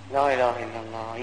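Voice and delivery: low, drawn-in devotional aside; grave, low pause for prayer; solemn invocation. Real, not acted. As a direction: drop the pitch right down for a sorrowful invocation. Pious aside after a death.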